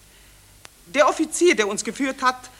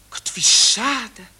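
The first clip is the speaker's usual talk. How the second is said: whispered regret; slower than usual. Acted. Enttäuscht, Vorstellung zu Ende.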